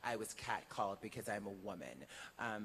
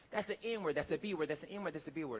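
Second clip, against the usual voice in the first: higher, louder back-and-forth squabble, imitated; mock street argument; 7 semitones higher, 6 dB louder than usual. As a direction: act out both guys bickering on the street. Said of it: "And then they began to argue".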